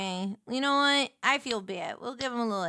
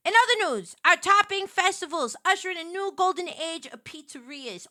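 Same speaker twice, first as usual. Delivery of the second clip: news anchor; 4 semitones higher, 5 dB louder than usual. Pretend. Mock newscast about pizza.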